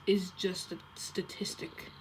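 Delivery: slow creepy whisper. Acted. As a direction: whisper it slowly and ominously. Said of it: "that is actually kinda creepy".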